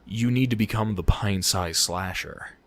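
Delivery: low, soft, creepy voice; hint of a sinister villain. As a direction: drop low and quiet, mildly menacing. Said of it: "she starts talking in this creepy fucking German accent".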